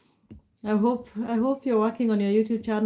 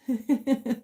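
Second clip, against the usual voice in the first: brief amused giggle; short chuckle; 3 semitones higher than usual. Real, not acted. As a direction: chuckle lightly for a moment. Amused by the fishing plan.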